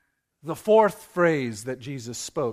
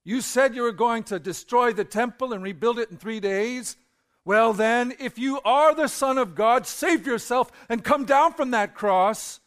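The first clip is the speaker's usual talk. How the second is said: taunting; 7 semitones higher, 5 dB louder than usual. Acted. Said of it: "shouted abuse".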